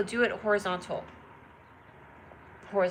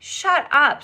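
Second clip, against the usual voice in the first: disbelief; 6 semitones higher, 11 dB louder than usual. Real, not acted. Reacting to surprising news.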